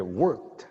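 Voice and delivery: "worked" in one beat, final kt joined; one syllable. Shown as a demonstration. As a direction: say "worked" as wərkt with no added vowel. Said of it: "하나로 worked".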